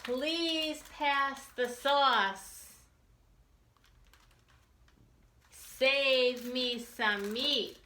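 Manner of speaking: loud, lively read-aloud with slow punchy refrains; reading to children. A narrator's reading. Teacher reading a picture book to children, lively and loud. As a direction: read it big and bouncy for the kids.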